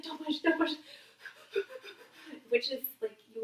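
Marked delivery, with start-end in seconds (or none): 0.0-0.7 urgent don't push. Retold or imitated. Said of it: "and you're like".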